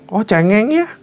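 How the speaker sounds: high mock tease; a bystander's high, teasing jab. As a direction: sneer at a crying child. Quoting someone calling child crybaby.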